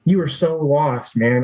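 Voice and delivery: real emotion rising in the voice; getting emotional. Real, not acted. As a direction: speak to your younger self, welling up. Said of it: "I'm getting emotional just thinking about it".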